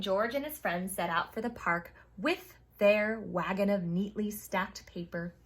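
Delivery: ordinary delivery, nothing marked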